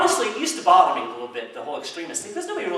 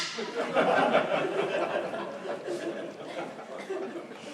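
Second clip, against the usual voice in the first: real laughter after a punchline; laughing; 14 semitones higher, 5 dB quieter than usual. Real, not acted. Speaker finishes the joke for the audience, laughing. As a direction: laugh at your own joke.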